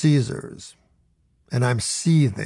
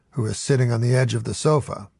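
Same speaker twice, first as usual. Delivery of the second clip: ordinary delivery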